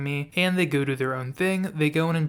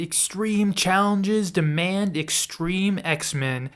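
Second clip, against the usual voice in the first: dramatic tagline read; 4 semitones higher, much slower than usual. Pretend. Reading the back-cover tagline.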